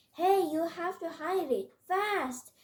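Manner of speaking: high, loud urging; urgent warning. A narrator's reading. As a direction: push them to hurry, punching "fast". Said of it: "Junta urged us".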